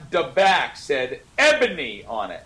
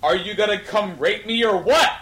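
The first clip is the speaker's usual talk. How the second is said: hammed-up yell, louder and higher; 8 semitones higher, 5 dB louder than usual. A narrator's reading. Friend reading fanfic aloud yells the line in mock outrage. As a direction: belt it out as a mock outraged shout. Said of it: "I yelled".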